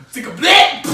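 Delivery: high, very loud mock yell; explosive imitation of the man's shout; imitated Russian outburst. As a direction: blurt the curse out at full volume. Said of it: "dead ass, he just".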